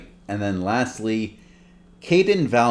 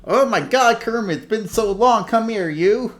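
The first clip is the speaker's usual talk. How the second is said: much higher excited bear voice; Fozzie Bear; 13 semitones higher, 4 dB louder than usual. Acted. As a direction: welcome an old friend with open arms. Fozzie greets Kermit.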